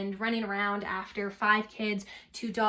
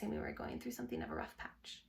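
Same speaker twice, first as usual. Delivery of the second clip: hushed, confiding whisper; whispered aside; 11 dB quieter than usual. A narrator's reading. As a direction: lean in and whisper it. Confiding aside about marriage trouble.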